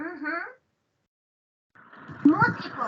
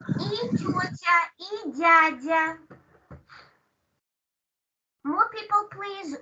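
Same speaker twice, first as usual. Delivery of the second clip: ordinary delivery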